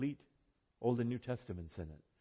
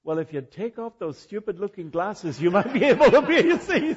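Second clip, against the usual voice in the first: much higher and louder, breaking into laughter; imitating a little boy; 12 semitones higher, 17 dB louder than usual. Toddler telling the blind woman to remove glasses, high laughing voice. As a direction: play the cheeky toddler, laughing through the words. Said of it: "and he says".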